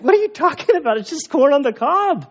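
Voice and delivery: high exasperated disbelief; it's only corn, come on; incredulous. Retold or imitated. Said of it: "And he's like".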